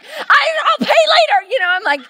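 high, loud, laughing yell; mock-panicked mother yelling; frantic mom shout. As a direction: demand your child back in a frantic, half-joking shout.

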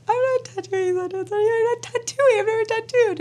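very high mock-crying wail; tearful protest. Crying wife refusing to tattoo husband, wailing protest. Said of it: "I just immediately started crying".

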